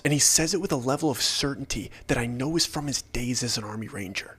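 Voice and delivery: usual speaking voice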